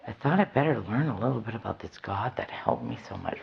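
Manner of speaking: hushed whisper. Real, not acted. Whispering to the audience about learning of God. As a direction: say it under your breath.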